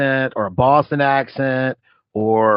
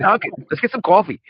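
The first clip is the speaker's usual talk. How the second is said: put-on Boston coffee-commercial accent, pitched up; mock Boston accent; 4 semitones higher than usual. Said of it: "those Ben Affleck, uh, Dunkin' commercials".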